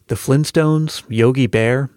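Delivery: ordinary talk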